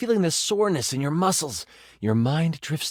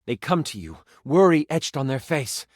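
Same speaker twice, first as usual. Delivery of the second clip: ordinary talk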